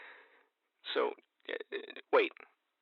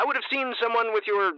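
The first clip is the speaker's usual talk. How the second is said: ordinary talk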